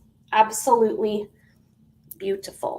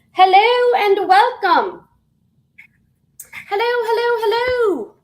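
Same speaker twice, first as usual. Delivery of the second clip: excited welcome; 11 semitones higher, 7 dB louder than usual. Real, not acted.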